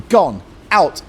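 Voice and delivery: ordinary delivery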